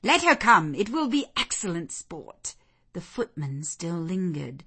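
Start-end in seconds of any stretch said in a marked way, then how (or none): 0.0-2.5 eager, raised youthful clamour; excited cry. Acted. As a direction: urge it on for fun. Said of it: "cried all the juveniles".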